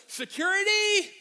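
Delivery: mock-panicked shout, last syllable held; calling for security. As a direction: holler for help at full volume. Imagines calling for security mid-sermon.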